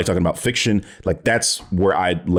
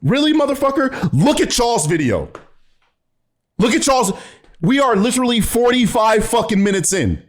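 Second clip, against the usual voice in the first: real exasperated shouting; exasperated outburst; 10 semitones higher than usual. Real, not acted. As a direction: yell at the screen in disbelief. Exasperated rant at the video.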